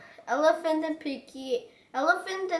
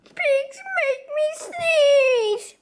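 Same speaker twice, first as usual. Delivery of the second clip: slow, higher, loud crying complaint; wailing; 12 semitones higher, 6 dB louder, much slower than usual. Acted. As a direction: wail it to the doctor. Gerald wails to Doctor Cat.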